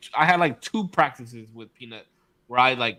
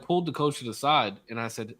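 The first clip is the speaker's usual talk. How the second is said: ordinary talk, nothing marked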